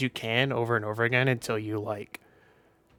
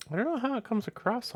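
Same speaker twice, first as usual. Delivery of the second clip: much higher voice; 11 semitones higher, 5 dB quieter than usual. Shown as a demonstration. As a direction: jump way up into a high, softer register. Repeats sentence in a higher voice.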